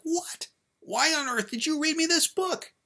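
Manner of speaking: very high, loud wail of outrage; outraged protest. Acted. Child blaming dad for reading this book, outraged. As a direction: explode in betrayed disbelief.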